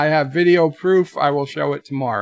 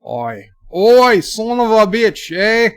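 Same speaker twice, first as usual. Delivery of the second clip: loud, high, drawn-out mock Aussie; Aussie accent; 6 semitones higher, 5 dB louder, slower than usual. Shown as a demonstration. Streamer trying out Aussie, loud mock accent. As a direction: holler it in a put-on Aussie accent. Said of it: "We can do Aussie. Let's see".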